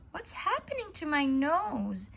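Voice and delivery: high, panicky question; sudden fright at his growing nose; alarmed cry. A narrator's reading. Pinocchio watching his nose grow, alarmed cry. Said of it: "he cried".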